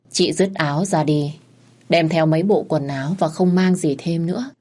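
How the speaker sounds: plain reading voice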